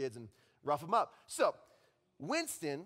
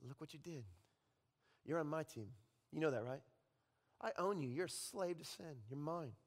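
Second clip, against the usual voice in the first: much softer, possessive taunt; quiet taunting Satan; 3 semitones lower, 8 dB quieter than usual. Acted. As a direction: claim them softly, like a devil. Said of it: "suddenly he says".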